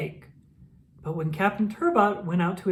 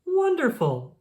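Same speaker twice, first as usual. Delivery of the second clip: much higher, delighted cry; thrilled exclamation; 11 semitones higher, slower than usual. Acted. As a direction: gasp it out in delight. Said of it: "said Mister Porter".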